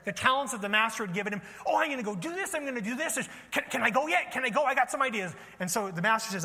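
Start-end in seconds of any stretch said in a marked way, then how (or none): 1.7-5.3 higher, bubbling with plans; can't wait to get going; eager servant. Acted. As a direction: play an eager worker raring to start. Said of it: "he's already thinking about the ways".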